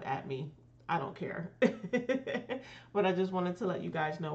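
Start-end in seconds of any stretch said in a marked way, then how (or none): 1.6-2.9 laugh. Real, not acted. Laughs at herself.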